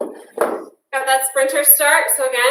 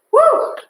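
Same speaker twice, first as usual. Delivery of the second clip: high, loud whoop of encouragement; excited woo; 13 semitones higher, 4 dB louder than usual. Real, not acted. Instructor cheering on the class mid-workout, high whoop. Cheering during squat kicks.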